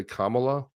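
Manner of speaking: Kamala as KAH-muh-luh, first syllable stressed; correct pronunciation. Shown as a demonstration. Host correcting co-host on the name, Kamala as KAH-muh-luh. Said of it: "if you don't say it right".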